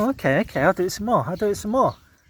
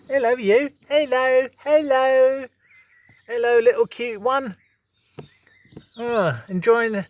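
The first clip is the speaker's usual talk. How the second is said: higher, cooing hellos to a rabbit; pet talk; 4 semitones higher than usual. Real, not acted. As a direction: coax the little rabbit sweetly. Talking to a rabbit.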